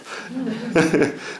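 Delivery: soft, high chuckle; laugh. Real, not acted. Nephew recalling the uncle's advice, soft high laugh. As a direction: chuckle quietly at the memory. Laughing after own quoted answer.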